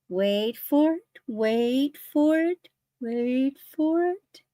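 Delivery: slow, drawn-out teasing build-up; suspense tease. Real, not acted. Building suspense before reveal.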